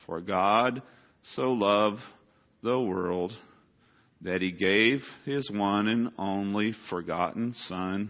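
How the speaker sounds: each phrase spaced out, slow; careful word-by-word recital; said very slowly. Retold or imitated. Child repeating the verse slowly as the teacher asked. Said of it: "I need you to say it very slowly".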